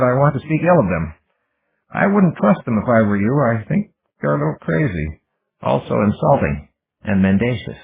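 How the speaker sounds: a newly cynical machine trying on family spite; Ghost's voice. Acted.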